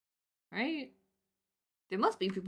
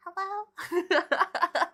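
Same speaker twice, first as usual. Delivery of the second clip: much higher, louder hello through a laugh; 11 semitones higher, 7 dB louder than usual. Retold or imitated. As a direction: answer high and giggling. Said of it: "And you're like".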